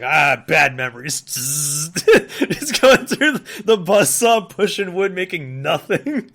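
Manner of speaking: loud comic rant about bad memories; mock angry. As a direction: act out an angry flashback, laughing.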